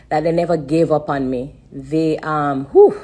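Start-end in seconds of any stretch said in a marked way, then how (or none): 2.7-3.0 high, loud whew to steady herself; emotional whew. Real, not acted. Telling viewers about grateful doctors, high loud whew. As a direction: push out a high loud whew to hold back tears. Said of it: "I don't wanna cry".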